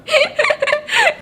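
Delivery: a high burst of laughter; high giggle. Real, not acted. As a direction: laugh at your own joke. Laughs at own joke.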